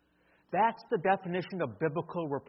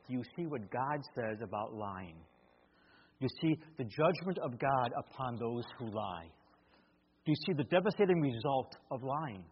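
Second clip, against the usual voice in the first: gentle earnest appeal; 5 semitones lower, 6 dB quieter than usual. Shown as a demonstration. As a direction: lean in quietly so she hears the weight of it. Father gently showing daughter Scripture.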